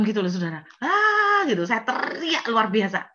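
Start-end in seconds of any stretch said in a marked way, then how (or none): 0.8-1.5 short high, loud yell; mock movie-style scream; demonstrated scream. Shown as a demonstration. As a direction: let out a brief high demo scream. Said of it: "saya teriak di sana. Kayak di film-film".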